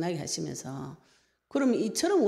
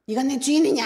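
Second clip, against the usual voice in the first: much higher and louder shout; 8 semitones higher, 9 dB louder than usual. Retold or imitated. Wife yelling back at her husband over church, shouting. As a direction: yell it at him in defiance. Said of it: "이렇게 막 고함을 지르고".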